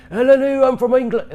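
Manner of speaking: a hammed-up prim English gent; posh English voice. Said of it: "I'm Batman".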